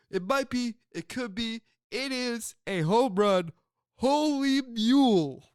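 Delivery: high, stretched announcer call ending in holy mule; mock the silly catchphrase as the announcer; Harry Caray impression. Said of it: "Charlie Finley wanted him to go".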